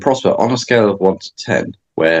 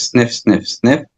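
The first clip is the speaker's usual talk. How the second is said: three quick sniffs in through the nose; sniffing the air; 4 semitones higher, much slower than usual. Shown as a demonstration. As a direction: sniff in three short parts. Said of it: "It's like this".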